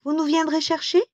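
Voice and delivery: high-pitched maidens' question; young maidens' voice. A narrator's reading. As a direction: ask it high, as the other maidens. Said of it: "Les autres ont dit". (voix aiguë de jeunes filles)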